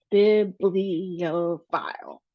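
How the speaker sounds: slow "bibliophile", syllable by syllable, strong first syllable; stressed syllables, drawn out. Shown as a demonstration. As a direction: sound out "bibliophile" slowly, hitting the opening BIB. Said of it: "we have the stress at the beginning".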